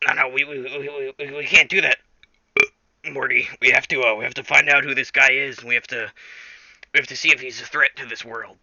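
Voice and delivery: stammering cartoon scientist impression; Rick. Rick insists to Morty they investigate the stranger, stammering Rick impression. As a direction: stutter insistently, pushing the plan. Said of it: "And Rick's like".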